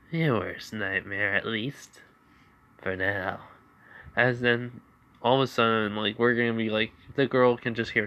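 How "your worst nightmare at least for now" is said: slow, quiet menacing line with a cackle; sinister taunt. Acted. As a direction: gloat darkly. Izuku taunting with a laugh.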